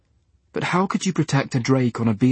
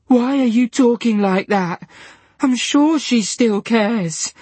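very high, slow, tearful; 10 semitones higher, 3 dB louder, slower than usual. A narrator's reading. Diana in tears begging Dylan. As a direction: plead while breaking down. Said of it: "breaking down into tears".

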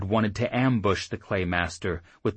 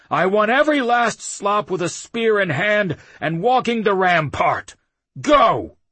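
loud, higher bellowing commands; shouted orders; 13 semitones higher, 8 dB louder than usual. A narrator's reading. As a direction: roar orders across the yard. Chief keeps yelling orders.

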